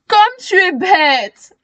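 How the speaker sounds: mock the silly girl. A narrator's reading. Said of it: "se moque-t-il".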